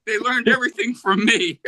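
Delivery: put-on high, boastful old bluesman bragging. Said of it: "And he goes".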